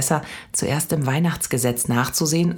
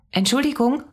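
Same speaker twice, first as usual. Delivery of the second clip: put-on child calling out; 12 semitones higher than usual. A narrator's reading. Child calls out to saleswoman.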